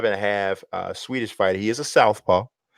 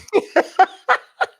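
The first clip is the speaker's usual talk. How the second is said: high, loud burst of laughter; 7 dB louder than usual. Real, not acted. Laughing at co-host's exasperation.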